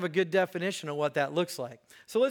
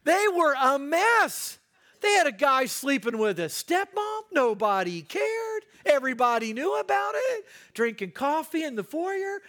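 high-pitched, animated preacher's rant; worked-up rant; 8 semitones higher, 4 dB louder than usual. Real, not acted. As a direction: pile up the scandals in disbelief. Worked-up rant on Corinth's scandals.

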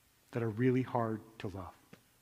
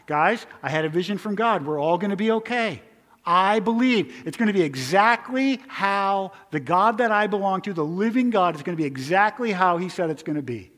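a hint of Paul, loud and certain; Paul announcing his vision; 5 semitones higher, 8 dB louder than usual. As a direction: proclaim the vision to the crew with conviction. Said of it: "He said that".

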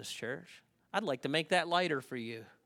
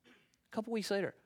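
ordinary delivery

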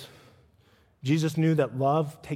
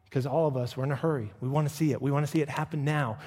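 usual speaking voice

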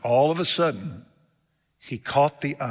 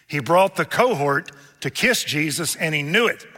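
raised, driving pulpit cadence with a falling refrain; forceful preaching; 6 semitones higher, 8 dB louder than usual. Real, not acted. Preacher recounting the warnings to the betrayer, forceful preaching. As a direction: hammer out each warning, louder and higher.

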